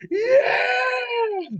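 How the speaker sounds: very high, loud drawn-out yell; mock crowd whoop. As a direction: holler like a crowd going wild. Crowd cheering as top comes down.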